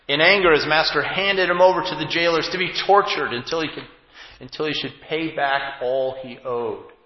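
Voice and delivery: loud, weighty reading of the parable; scripture reading. Acted. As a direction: proclaim the scripture to the congregation. Reads the parable's end.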